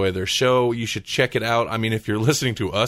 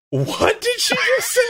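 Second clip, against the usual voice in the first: high-pitched, drawn-out shout through laughter; shocked reaction; 4 dB louder than usual. Retold or imitated. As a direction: exclaim it in hammed-up disbelief, laughing. Reacting while editing the episode.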